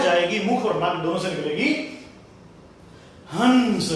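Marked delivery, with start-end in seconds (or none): 3.3-3.9 हंस with a sounded nasal "n"; हंस said firmly as "hans"; air through nose. Shown as a demonstration. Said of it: "हवा नाक से निकलेगी".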